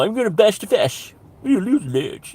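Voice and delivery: high mock posh accent. Comedian mimicking those types, mocking posh accent. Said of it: "I always hear it with a posh upper accent".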